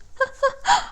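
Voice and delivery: high laugh on the edge of crying; tearful laugh. Real, not acted. Reader laughing through tears while voicing the ancestors. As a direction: let a teary laugh break out up high.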